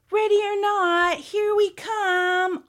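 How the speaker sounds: high, loud sing-song call; hide-and-seek holler; yelling. A narrator's reading. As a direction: call out the hide-and-seek warning, stretched at the end. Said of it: "Oliver yelled".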